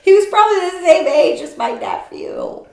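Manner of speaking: high, loud joking tone; teasing remark. Real, not acted. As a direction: tease with a mock-scandalized lift. Joking about the young man.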